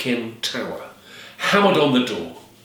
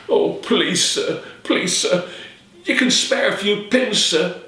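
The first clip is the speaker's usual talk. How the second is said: put-on begging girl; 8 semitones higher, slower than usual. Alison begging the peddler.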